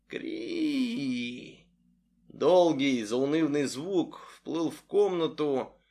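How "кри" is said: high thin squeak; shrill phone squeak. A narrator's reading. Ring of the phone. (тонкий писк телефона)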